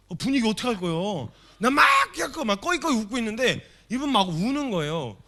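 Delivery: high, loud, bursting with laughter; excited retelling. Real, not acted. Preacher to congregation, excitedly laughing. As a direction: tell it like you can't stop laughing.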